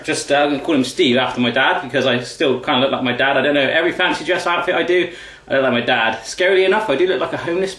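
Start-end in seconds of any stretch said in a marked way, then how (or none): none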